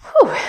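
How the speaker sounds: high, loud puff of effort; exertion whew. Real, not acted. Coach midway through squat reps, high exertion whew. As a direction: let out a high whooped whew.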